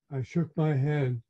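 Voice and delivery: usual speaking voice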